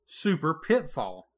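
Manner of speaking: ordinary delivery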